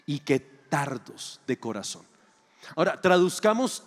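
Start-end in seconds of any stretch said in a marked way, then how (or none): 0.0-2.0 a weighty, deliberate rebuke; Jesus rebuking, low and slow. Acted. Jesus gently scolding his disciples, low and very slow. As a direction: drag each word out, lower and heavy. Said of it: "Jesús los mira y les dice".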